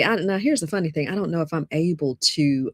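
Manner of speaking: ordinary talk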